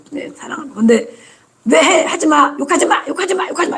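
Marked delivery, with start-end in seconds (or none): 1.7-3.8 high, insistent stop swearing; scolding parent. Retold or imitated. Parent repeating stop swearing at the child, high and sharp. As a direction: nag higher each time.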